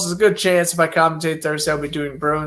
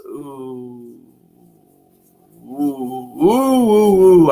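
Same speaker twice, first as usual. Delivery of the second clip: drawn-out ooh; 2 semitones lower, 3 dB louder, much slower than usual. Real, not acted. Weighing a tough matchup.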